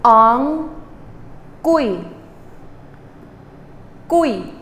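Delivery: ong then kui, each syllable said separately and clearly; ong kui drill. Shown as a demonstration. Teacher drills ong then kui for the class, separate careful syllables. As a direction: drill the syllables one at a time.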